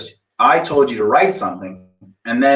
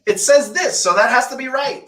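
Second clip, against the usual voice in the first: much higher, mocking imitation; 9 semitones higher than usual. Mocking a student's stubborn claim in a high voice. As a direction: squeak it out like a know-it-all. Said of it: "that's you making a statement".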